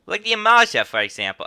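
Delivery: high, loud New York jump on "Amalj'aa"; exaggerated New York accent attempt. Innkeeper gives an example, hammed-up New York accent.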